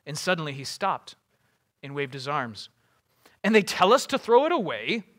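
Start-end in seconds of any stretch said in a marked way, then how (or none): 3.4-5.0 indignant, high and loud; Boromir's indignant cry. Acted. Warrior objecting to the council's plan, indignant cry. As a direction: cry out in disbelief. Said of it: "he cried".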